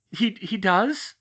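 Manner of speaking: slow, stunned gasped question; a mild gasp of shock. Acted. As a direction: catch the breath, hurt and surprised. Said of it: "she gasped".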